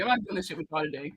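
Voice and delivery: usual speaking voice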